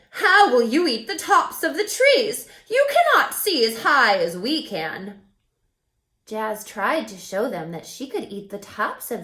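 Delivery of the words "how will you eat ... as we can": loud, higher mocking giraffe voice with a laugh; teasing giraffes. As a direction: laugh down at her from up high. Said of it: "They said".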